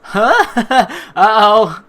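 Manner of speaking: ordinary talk, nothing marked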